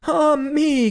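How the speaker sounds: high, anguished cry. Acted. Wife tormented by the bird's song, anguished cry. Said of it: "cried the wife".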